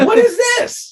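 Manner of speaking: high, loud incredulous squeal; stunned shout of disbelief; shocked disbelief. Retold or imitated. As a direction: yelp it out like you can't believe your eyes. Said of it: "I'm like".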